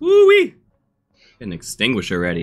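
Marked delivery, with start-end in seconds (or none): 0.0-0.5 high loud burning woo-wee; pained woo-wee. Real, not acted. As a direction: yelp it out through the heat. Reacting to burning spicy chip.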